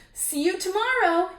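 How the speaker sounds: high, loud singsong taunt; loud high teasing goodbye; teasing brat. A narrator's reading. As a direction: taunt her cheerfully. Wendell taunting at bedtime.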